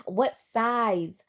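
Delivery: ordinary delivery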